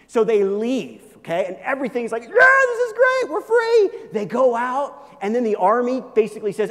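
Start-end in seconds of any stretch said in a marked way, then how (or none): 2.2-3.9 high, loud gleeful cheer of freed slaves; the people whooping at their freedom; mock celebration. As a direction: cheer it like a crowd just set free.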